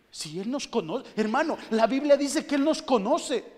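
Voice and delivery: loud, high preacher's cry; rising to a fervent shout from the pulpit; preaching shout. Acted. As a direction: build to a full-force preaching shout.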